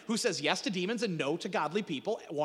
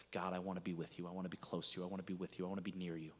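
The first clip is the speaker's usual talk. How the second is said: longing plea; 12 semitones lower, 13 dB quieter, faster than usual. Acted. Voicing the saved longing for God.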